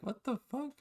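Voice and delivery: high, hushed what-the-hell; a sudden shocked jump in pitch; startled. Retold or imitated. Startled by stranger's voice.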